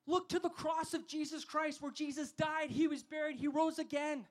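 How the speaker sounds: usual speaking voice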